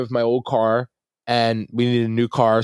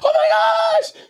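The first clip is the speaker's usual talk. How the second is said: very high excited shriek; reenacted winner's high-pitched cry; thrilled squeal; 3 dB louder, slower than usual. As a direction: squeal in amazed delight. Reenacting reaction to winning bid.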